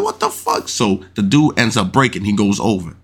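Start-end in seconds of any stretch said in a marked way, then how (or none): 0.0-0.7 very high squeak of disbelief; imitating stunned player. Retelling the gambler losing the hand, squeaky shocked mock cry. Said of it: "And the dude's like".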